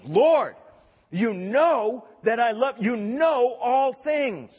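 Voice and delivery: loud, high anguished outburst; eruption of pain. Acted. Peter protesting his love to Jesus, erupting in anguish. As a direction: cry it out, drawn out and pleading. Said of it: "this eruption of pain".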